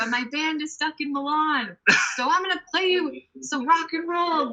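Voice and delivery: high, projected stage-banter voice, re-enacted; addressing the crowd. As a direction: imitate a performer asking an audience's indulgence.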